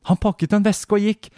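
put-on a frightened child telling what he saw; scared boy. Boy answering the mother. (redd barnestemme, mye lysere)

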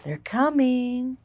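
slow eerie warning; ominous movie voice. Shown as a demonstration. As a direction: drag it out like an alien film trailer. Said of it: "Does it sound like an alien movie".

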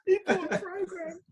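high, laughing through the words; can barely talk for laughing. Real, not acted. Laughing while retelling.

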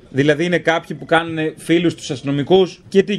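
ordinary talk, nothing marked

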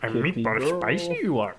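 lightly voiced Yoda without the Italian accent; Yoda word order, no accent. Cohost mangling a meatball line as Yoda, lightly voiced. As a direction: flip the words like Yoda, keep it plain. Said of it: "I forgot to do the accent".

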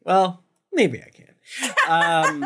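slow laughing mock threat; laughing mock grumble. Real, not acted. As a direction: tease with a laugh. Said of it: "I can't stay mad at you".

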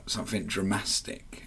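"dramatic" said with an extra s, as dra-MAS-tic; mispronounced word. Retold or imitated. Tarot reader telling a client her card's meaning, mispronouncing "dramatic" as "dramastic". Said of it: "Dramatic is not a word".

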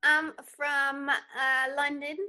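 put-on a light, half-hearted British accent; barely-there London accent, pretending; mock British accent. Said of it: "in your British accent".

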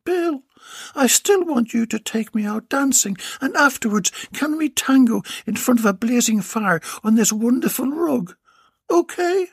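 high, coaxing lover's voice; sweetly pleading young woman; young woman's voice. Acted. Ivanova speaks to Bill.

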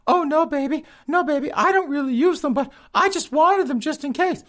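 high falsetto, hammed-up sweet-talking girlfriend; sheepish girlfriend. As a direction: coo like a caught-out girlfriend in a squeaky voice. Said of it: "girlfriend answers boyfriend".

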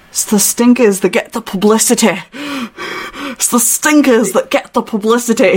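Yorkshire-style accent; put-on Yorkshire accent; northern English accent. Shown as a demonstration. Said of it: "like, more northern".

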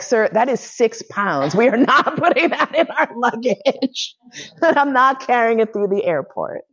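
mock-stern quote, breaking into laughter; giggling through a polite scolding; laughing. To her husband about the hammer, laughing through it.

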